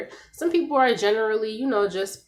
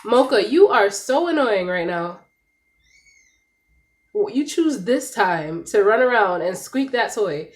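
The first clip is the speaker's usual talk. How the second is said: annoyed scolding; 6 dB louder than usual. Real, not acted. Scolding her noisy dog.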